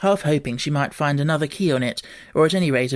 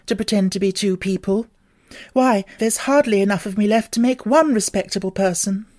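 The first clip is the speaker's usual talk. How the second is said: put-on higher girlish voice, ruefully; a small girl grumbling at her size; rueful Alice; 5 semitones higher than usual. Alice's musing.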